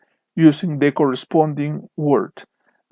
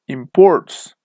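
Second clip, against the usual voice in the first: imPORTS, loud second syllable; verb stress; 5 dB louder than usual. Shown as a demonstration. Teacher fills in the verb answer, stress on PORTS in "imports". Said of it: "because it's a verb".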